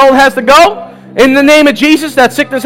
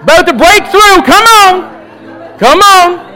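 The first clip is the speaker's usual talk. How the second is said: fervent shouting; 6 semitones higher than usual. Real, not acted. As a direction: urge them on, shouting. Shouting in worship fervor.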